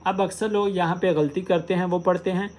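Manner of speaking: ordinary talk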